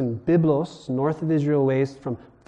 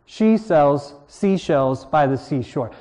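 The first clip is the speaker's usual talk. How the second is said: she sells seashells said slow, leaning on each s and sh; alliteration demo; slower than usual. Shown as a demonstration. Teacher showing the class alliteration, slowly and deliberately. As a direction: roll out the s sounds slowly. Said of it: "You know what alliteration is".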